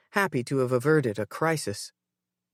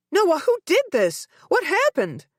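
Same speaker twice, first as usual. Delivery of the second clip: frantic high shriek, louder; panicked mother shrieking; 4 dB louder than usual. A narrator's reading. Mother to unconscious son, frantic shriek. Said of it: "I shrieked".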